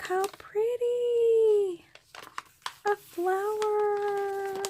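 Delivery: high, slow, drawn-out coo. Real, not acted. Admiring a packaged flower, cooing high and slow. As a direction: coo over it like something precious.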